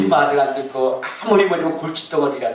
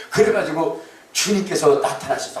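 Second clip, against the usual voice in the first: usual speaking voice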